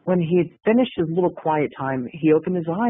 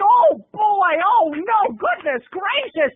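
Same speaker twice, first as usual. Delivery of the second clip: much higher, prim shocked voices; mock prudish friends; 13 semitones higher, much slower than usual. Kenny's church friends gasp at the dancer, high and prim. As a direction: clutch the pearls, pitched way up.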